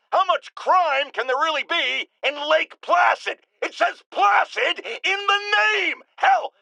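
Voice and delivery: ranting. Acted. Ranting about borrowing cops.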